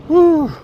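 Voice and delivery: high, loud breathless exhale; winded whew. Real, not acted. Out-of-breath vlogger on the bridge puffs out hard. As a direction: blow out air, still catching breath. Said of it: "I'm out of breath".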